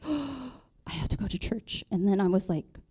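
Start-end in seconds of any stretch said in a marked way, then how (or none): 0.1-1.8 awed hush. Retold or imitated. Awed realization after gasp.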